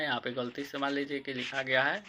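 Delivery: normal talking voice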